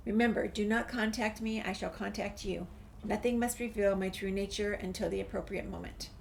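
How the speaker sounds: normal talking voice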